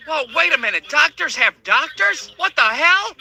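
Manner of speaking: high, slowed amazed question; mock surprise. Patient to doctor, a high slowed mock astonishment. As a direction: marvel at it, wide-eyed.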